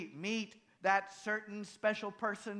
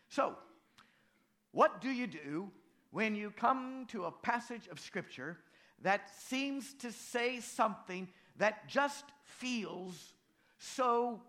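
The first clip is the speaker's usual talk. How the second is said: normal talking voice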